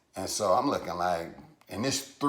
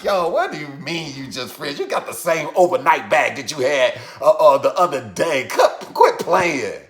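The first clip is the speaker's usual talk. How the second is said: raised, insistent calling-out; indignant challenge; 5 semitones higher, 7 dB louder than usual. Retold or imitated. Challenging her claim they are just friends, indignant. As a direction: act out scoffing disbelief, louder toward the end.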